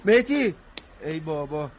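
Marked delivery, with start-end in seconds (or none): 0.0-0.5 loud call after someone walking away. Acted. Calling after Mehdi as he storms off, loud. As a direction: shout his name so he stops.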